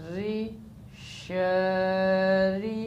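slow, stretched Quranic syllables; spelled out in tajweed drill; drawn-out recitation. Shown as a demonstration. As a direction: chant each syllable long and even. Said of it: "रा ज़ेर".

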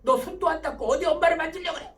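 mock-stern scolding, pitched high and loud; scolding mother. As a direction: act out a mother telling off her child. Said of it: "그럼 뭐라고 혼내켜요?".

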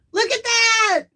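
real high, loud cry of delight; thrilled. Real, not acted. Birthday host seeing the gift working, thrilled. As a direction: burst out high with joyful amazement.

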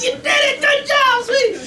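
very high sing-song praise; happy praise. Retold or imitated. Parent cheering toddler on the potty, very high. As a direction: cheer her on sweetly. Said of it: "You're all happy".

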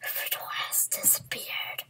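hushed sneaky whisper. Pretend. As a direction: whisper to stay hidden.

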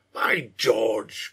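mildly acted exclamation, timbre changed; exclaiming. A narrator's reading. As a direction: exclaim it in character with mock amazement. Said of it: "Green exclaimed".

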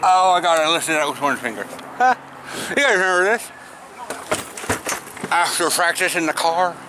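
talking around a mouthguard; mouthguard in mouth. Real, not acted. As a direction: lips held open by guard. Seen 1: Green mouthguard clearly in mouth throughout, removed around 21s.

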